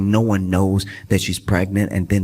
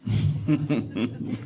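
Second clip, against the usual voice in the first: laughing; 7 dB quieter than usual. Real, not acted. Laughing still.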